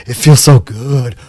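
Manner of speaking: hammed-up moans of pleasure; imitated moaning. [name] reacting to Melody's touch, mock moaning. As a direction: draw out each oh in mock ecstasy.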